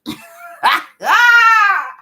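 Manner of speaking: high, loud burst of laughter; loud shrieking laugh. Real, not acted. Laughs at the finishing line.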